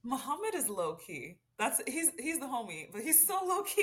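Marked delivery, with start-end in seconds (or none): 2.9-3.8 emphatic teasing. Real, not acted. Teasing praise of a friend.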